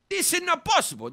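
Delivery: normal talking voice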